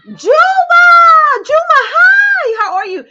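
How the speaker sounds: loud high-pitched squeal of hello; excited greeting. Real, not acted. Host greeting a viewer who just joined, excited shout. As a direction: shout it high and long, thrilled.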